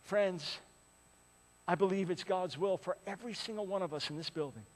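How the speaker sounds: ordinary delivery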